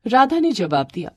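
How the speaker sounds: ordinary delivery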